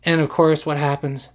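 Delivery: ordinary talk, nothing marked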